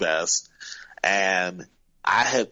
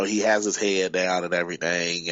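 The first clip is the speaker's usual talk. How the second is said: normal talking voice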